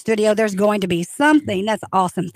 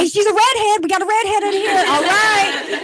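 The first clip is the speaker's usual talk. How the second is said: delighted exclaim; 9 semitones higher than usual. Real, not acted. Host delighted by redhead girl.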